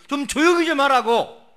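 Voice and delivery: loud, high mock rebuke; scolding. Crowd hushing the blind man, scolding him loudly. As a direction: scold the noisy man at full voice. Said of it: "막 꾸짖어요".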